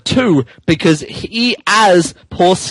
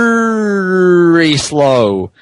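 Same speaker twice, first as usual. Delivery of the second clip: drawn out slow; much slower than usual. Shown as a demonstration. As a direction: stretch very slow out. Reading reenacts the slowness.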